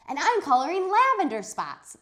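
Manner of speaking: high, loud chirpy announcement; proud of her color; eager girl. Acted. Charlotte proudly announces her color, high and loud. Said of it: "said Charlotte".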